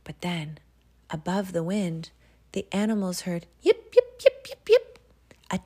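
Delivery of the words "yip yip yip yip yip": very high, loud dog yips; husky yips. Acted. Husky team yipping above the storm, very high and loud. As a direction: yelp like excited sled dogs.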